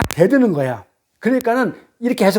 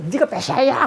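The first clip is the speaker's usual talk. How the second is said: higher-pitched accusation, acted out; mock quarrel; 5 semitones higher than usual. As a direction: imitate someone arguing and pointing the finger. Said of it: "둘이 삿대질하면서". (삿대질하며 따지는 흉내)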